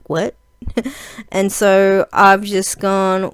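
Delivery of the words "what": soft WHAT with a rising end; questioning. Retold or imitated. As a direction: say WHAT like a little question mark. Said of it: "Like, little question mark".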